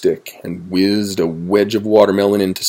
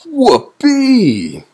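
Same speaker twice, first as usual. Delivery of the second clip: very high, loud hooray; joyful whoop; 6 dB louder than usual. A narrator's reading. Owl gets a book, whooping with joy. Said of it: "cried the owl".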